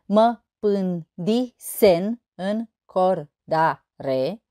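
slow, evenly spaced syllables. Shown as a demonstration. Teacher showing eight syllables in a verse, syllable by syllable. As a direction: sound out one syllable at a time. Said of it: "opt silabe".

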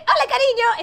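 high, loud sing-song mother's hello; mock mom greeting. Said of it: "esta es tu madre". (saludo de madre, agudo y fuerte)

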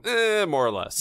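imitating an offhand so-so; noncommittal maybe. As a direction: hedge it like a so-so verdict. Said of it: "like, the maybe motion and go".

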